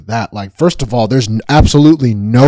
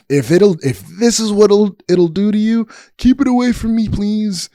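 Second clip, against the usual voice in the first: mock plea; 9 semitones higher than usual. Pleading to keep love away.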